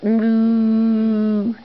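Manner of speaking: loud, playful moo; playful cow moo. Pretend. Cow sound for toddler.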